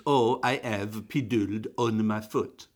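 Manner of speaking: French accent. Shown as a demonstration. Host showing a key phrase for getting into a French accent. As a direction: demonstrate a silly French accent. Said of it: "the key phrase I was taught for a French accent".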